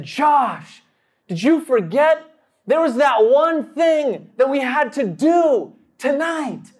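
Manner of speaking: high, emphatic, drawn-out reminder; insistent calling out. Retold or imitated. Calls out pointedly to his friend.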